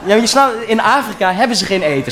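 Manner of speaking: ordinary delivery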